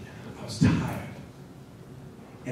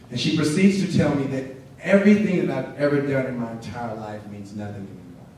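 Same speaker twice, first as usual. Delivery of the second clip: ordinary talk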